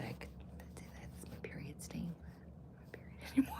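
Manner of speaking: embarrassed whisper. Real, not acted. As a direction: confess it under your breath.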